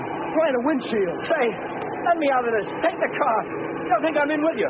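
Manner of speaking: high, rushed begging to be let out; frightened hostage begging the gangsters; scared pleading. Acted. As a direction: plead to get out of the car in fear. Gunfire in getaway car, scared.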